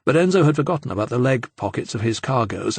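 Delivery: ordinary delivery, nothing marked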